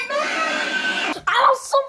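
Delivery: very high, frenzied screamed outburst; screaming. Acted. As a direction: scream it out in a fury. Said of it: "from me screaming".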